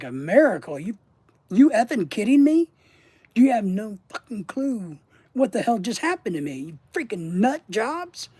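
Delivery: mock-furious you-kidding-me; angry retort. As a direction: snap back at them in disbelief. Angry retort to miracle talk.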